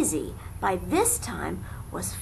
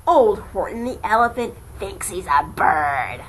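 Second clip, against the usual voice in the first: mocking yell; 8 dB louder than usual. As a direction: stretch out the mockery. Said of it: "they yelled".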